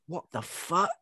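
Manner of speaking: a hint of a horrified Carly; mock shocked. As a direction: act out a horrified friend. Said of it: "and Carly goes".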